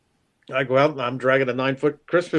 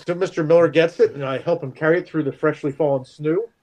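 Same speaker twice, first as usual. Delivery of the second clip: ordinary talk, nothing marked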